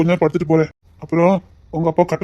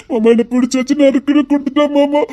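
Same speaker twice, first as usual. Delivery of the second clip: mock whiny child; 9 semitones higher, 4 dB louder than usual. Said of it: "ஓன்னு அழுத தெரியுமா".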